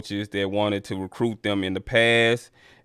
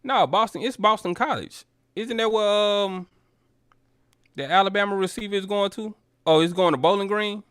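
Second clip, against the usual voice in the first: pitched way up, puzzled and checking himself; confused surprise; 10 semitones higher than usual. Real, not acted. Realizing he named the wrong school, high and puzzled. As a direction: caught off guard, sorting out the mix-up aloud.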